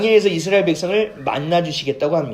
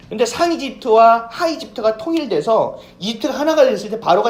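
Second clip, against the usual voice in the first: raised, forceful sermon voice; preaching; 4 semitones higher, 5 dB louder than usual. Acted. Pastor preaching to the congregation, forceful. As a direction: drive the point home to the congregation. Preaching about Pharaoh's power.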